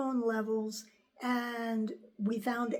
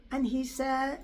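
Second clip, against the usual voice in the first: ordinary talk, nothing marked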